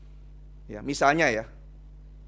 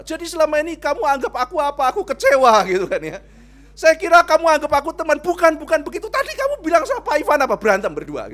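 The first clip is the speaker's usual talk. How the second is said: high, loud upset protest; a hurt schoolgirl shouting at her friend; hurt friend's outburst; 9 semitones higher, 9 dB louder than usual. Retold or imitated. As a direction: imitate the offended friend shouting her disappointment. Angry friend confronting crying girl.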